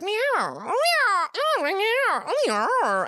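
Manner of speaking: high meowing cat imitation. Comic imitating the two noisy cats outside, high meows. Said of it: "which are just like".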